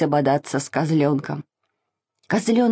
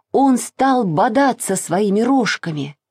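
plain reading voice

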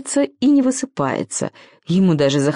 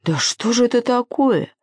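higher, shocked exclamation; gasping dismay; 6 semitones higher than usual. A narrator's reading. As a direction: exclaim as if aghast. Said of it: "Пауки дружно охнули".

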